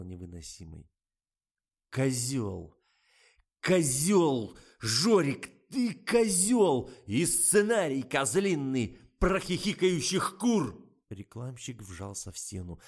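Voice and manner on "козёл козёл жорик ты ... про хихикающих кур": loud, high, slow furious yell. A narrator's reading. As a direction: explode at him. Director exploding at publicist.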